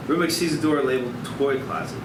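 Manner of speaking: ordinary talk, nothing marked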